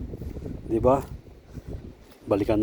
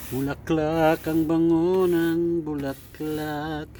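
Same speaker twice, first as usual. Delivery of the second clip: slow dreamy admiring; 4 semitones higher, much slower than usual. Real, not acted. Admiring fragrant flowers, slow.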